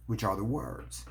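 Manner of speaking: usual speaking voice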